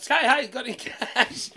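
high, loud, drawn-out beggar's call; hammed-up begging from a car; mock car beggar. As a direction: call out for cash like a cheeky beggar.

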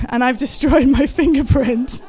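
laughing through the words; rueful laugh while telling it. Real, not acted. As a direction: let a real laugh break into the line. Laughing at own mishap.

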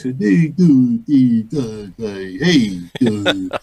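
Bullwinkle voice. Retold or imitated. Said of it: "try to do the voice".

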